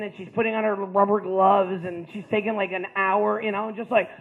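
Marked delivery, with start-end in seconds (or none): none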